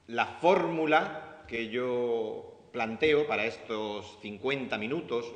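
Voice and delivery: usual speaking voice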